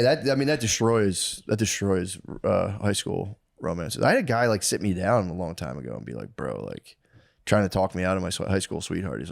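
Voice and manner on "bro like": much lower, softer "Bro"; lower, softer mock mentor to young man; low mock advisor. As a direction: drop into the wise older guy. Older guy giving advice.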